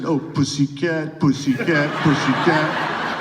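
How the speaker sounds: imitated great-grandmother's German accent, drawn out; thick German accent. Great-grandmother mishearing a car commercial, thick German accent. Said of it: "in her thick German accent".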